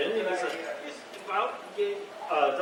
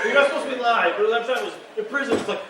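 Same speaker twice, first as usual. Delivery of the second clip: usual speaking voice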